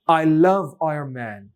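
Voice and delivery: slow, "Iron Man" linked as "aarm-en"; practice line. Shown as a demonstration. Teacher gives students a sentence to practise. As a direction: draw out the line, n turning into m. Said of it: "বলতে পারেন".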